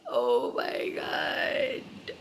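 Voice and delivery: low, drawn-out unvoiced oh my God; shocked gasp. Real, not acted. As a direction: breathe it out slowly in disbelief. Reacting to new information.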